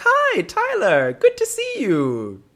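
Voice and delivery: high, drawn-out mom greeting; over-sweet mother. Retold or imitated. Tyler's mother greeting him over morning coffee, over-sweet. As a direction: sing out a slow, high hello.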